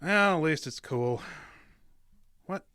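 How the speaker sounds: ordinary talk, nothing marked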